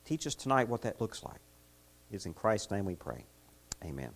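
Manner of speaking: soft, reverent closing prayer; hushed reverent prayer. Acted. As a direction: pray quietly to close. Closing prayer before sermon.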